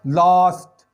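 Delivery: teacher's model of "लास्ट"; model pronunciation. Shown as a demonstration. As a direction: demonstrate "लास्ट" with the long aa. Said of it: "आ की आवाज़ देनी है".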